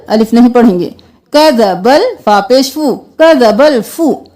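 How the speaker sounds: ordinary talk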